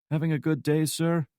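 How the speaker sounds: high-pitched, routine customer greeting; bored cashier. A narrator's reading. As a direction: rattle it off, going through the motions. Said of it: "he asked, going through the motions".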